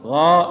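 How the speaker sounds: غاء slow with a dark full alif; heavy alif after غ. Shown as a demonstration. Teacher contrasting the heavy alif after غ. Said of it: "نفخمها".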